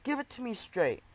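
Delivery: high squeaky begging; pleading squeak. A narrator's reading. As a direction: squeak it out imploringly. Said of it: "I squeaked".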